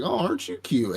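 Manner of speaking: high cooing voice; high, sweet mock baby talk. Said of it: "He's like".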